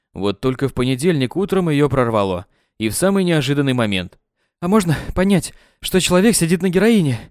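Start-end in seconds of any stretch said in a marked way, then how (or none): 4.6-7.2 Katya voice. A narrator's reading. Katya asks coach.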